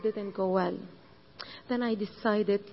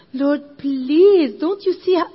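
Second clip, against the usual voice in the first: loud, higher begging; pleading; 4 semitones higher, 10 dB louder, slower than usual. Retold or imitated. Jesse begging the Lord, loud and higher. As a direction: beg desperately.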